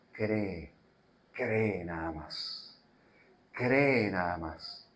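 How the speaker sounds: slow, low, soft encouragement; Jesus softly telling Jairo only to believe; gentle urging. Acted. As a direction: urge him to believe, very slowly. Jesus urges faith softly.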